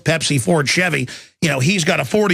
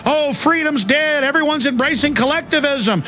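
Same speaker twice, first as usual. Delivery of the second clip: mock desperate wailing; 9 semitones higher than usual. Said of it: "desperately saying".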